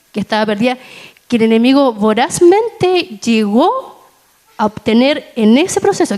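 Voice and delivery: ordinary delivery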